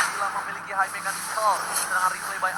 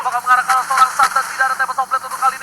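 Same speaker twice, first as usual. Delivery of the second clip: play-by-play; 8 semitones higher, 12 dB louder than usual. Real, not acted. Live esports play-by-play.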